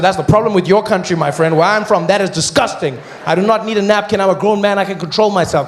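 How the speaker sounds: a loud, laughing scolding of the vendor; mock lecture. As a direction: lecture him like a proud grown man. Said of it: "I said".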